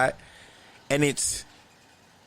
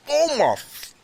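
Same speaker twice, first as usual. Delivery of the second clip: shocked gasp; 13 semitones higher than usual. Retold or imitated. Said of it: "He looks in the window and goes".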